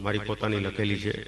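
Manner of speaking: ordinary talk, nothing marked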